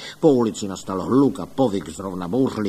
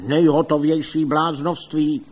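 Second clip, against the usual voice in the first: loud, raised outburst; blustering, louder and higher; indignant shout; 5 semitones higher, 5 dB louder than usual. A narrator's reading. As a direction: cry out in scornful protest. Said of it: "křičel pan doktor Link".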